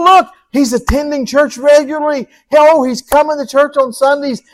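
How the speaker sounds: a beaming father calling the angels to look; God rejoicing over his child; God the proud Father. Acted. Voices God watching his child.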